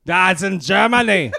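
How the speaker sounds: slow, lightly put-on German accent on "That's in Germany"; a hint of a German accent, slow; mock German accent. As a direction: drag it out in a hammed-up German accent. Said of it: "Do that again".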